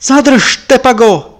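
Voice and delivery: high, loud alarmed cry. Acted. Lama's wife cries out to stop Tepaga's knife, alarmed. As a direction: stop him with a sudden scream. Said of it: "vykřikla".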